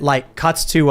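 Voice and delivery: ordinary talk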